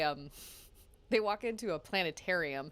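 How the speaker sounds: normal talking voice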